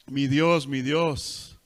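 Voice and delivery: loud anguished cry. Acted. Jesus on the cross cries to God, loud anguished call. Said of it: "Y gritaba".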